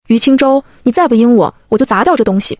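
plain reading voice

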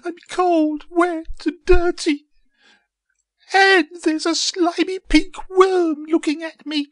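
very high, loud squeaky little seed; hammed-up high-pitched lament; wailing seed. A narrator's reading. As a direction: wail in distress in a tiny high voice. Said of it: "she cried".

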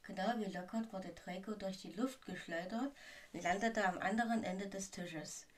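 usual speaking voice

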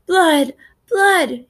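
high, restrained wail of alarm; narrator's child-like yell. A narrator's reading. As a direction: cry out in horror, pitch leaping then falling. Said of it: "he yells".

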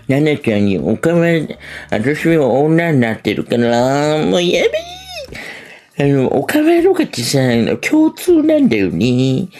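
a hint of a slow, sugary okama; okama impression. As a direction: drag it out like a sweet okama friend. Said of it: "とかっつって".

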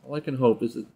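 normal talking voice

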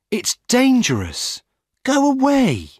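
high, loud, rising shout. Acted. Young woman chases the jogger off, high loud shout. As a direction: drive him off with a yell. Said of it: "a young woman shouts at him".

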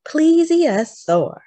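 Plesiosaur said slow and loud, each syllable clear; careful naming. Shown as a demonstration. Narrator teaches children the name, slow and clear. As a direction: sound out Plesiosaur slowly.

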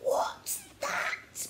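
very high, scared whispered cry; high panicked child's gasp of a question; frightened cry. A narrator's reading. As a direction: shrink back from the creature in fright. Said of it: "screamed upon seeing the creature".

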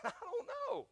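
high, imitated helpless reply; helpless answer. Husband answering his frightened wife, mock helpless. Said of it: "I said".

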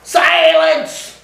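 high-pitched, strident shout; shrill commanding scream of silence. A narrator's reading. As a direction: shriek the command. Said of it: "screamed the strident, high-pitched voice".

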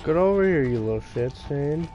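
normal talking voice

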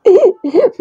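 short high-pitched giggle. Real, not acted. Laughing after mixing up the words on camera. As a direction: burst into a quick high giggle.

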